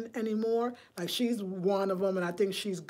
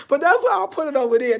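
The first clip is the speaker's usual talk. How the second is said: high, loud, laughing through the words; 6 semitones higher, 10 dB louder, faster than usual. Real, not acted. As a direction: talk fast through a laugh. Laughs at own remark.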